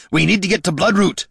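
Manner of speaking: loud, high-pitched urgent shout; roaring. A narrator's reading. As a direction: roar the order to move. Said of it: "roared Gorm".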